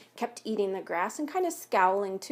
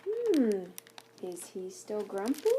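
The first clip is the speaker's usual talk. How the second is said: plain reading voice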